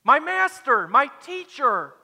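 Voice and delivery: high, loud, overjoyed outcry; astonished cry of recognition. Acted. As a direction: cry out to a beloved teacher. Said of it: "she turns around instantly and says".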